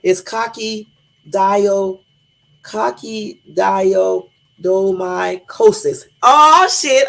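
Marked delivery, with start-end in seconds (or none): 2.6-6.0 sounding out coccidioidomycosis syllable by syllable, very slow; slow careful attempt. Shown as a demonstration. Streamer slowly attempting the hard fungus name. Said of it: "But then again, I will, so y'all can laugh". 6.2-7.1 loud high "Oh, shit" of victory; triumphant shout. Real, not acted. Streamer cheering after saying the word right. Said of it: "I did it".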